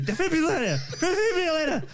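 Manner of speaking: delighted laughing shout; laughing exclaim. Real, not acted. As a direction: shout the word, cracking up. Host laughing at hard word.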